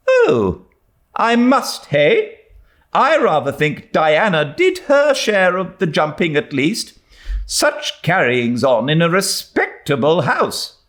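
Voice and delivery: put-on high, tart old lady scolding; sharp and unimpressed; stern old lady. A narrator's reading. Miss Barry to Anne, tartly scolding.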